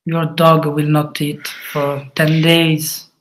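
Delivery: usual speaking voice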